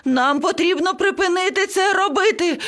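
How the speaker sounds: high-pitched parrot panic; screeching. A narrator's reading. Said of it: "зарепетував папуга".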